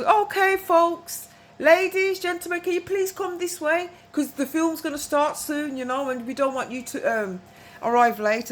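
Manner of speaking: high, loud call to the whole queue. Retold or imitated. Calling the queue at the venue.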